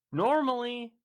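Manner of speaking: NORMALLY held high and loud. Real, not acted. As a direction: stretch NORMALLY on a high, level note. Stretches the first take for effect.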